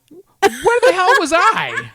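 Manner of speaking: high, loud, drawn-out disbelief; incredulous outburst. Real, not acted. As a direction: cry out in astonishment, half laughing. Astonished at partner's unknown meeting.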